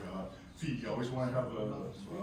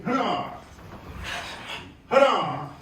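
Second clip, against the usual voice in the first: sharp barked count; count shout; 8 semitones higher, 8 dB louder, slower than usual. Real, not acted. Instructor barking the count to students, loud. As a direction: call the count out to the class. Martial arts count called out.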